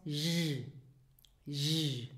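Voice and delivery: "J" as a sustained zh. Shown as a demonstration. Teacher explaining G after vowels, sustained zh. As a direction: hold "J" like French j in je. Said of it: "then it is pronounced".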